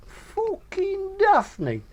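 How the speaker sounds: plain reading voice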